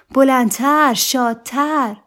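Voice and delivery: high, loud, drawn-out cheerleading call; loud urging. A narrator's reading. Wife demanding livelier singing from husband, urging loudly. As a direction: urge him on at the top of the voice.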